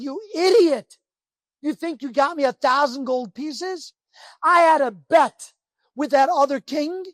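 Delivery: a king exploding in rage; furious shout. Acted. King yelling at his servant who lost the bet, furious shout. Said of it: "he's furious".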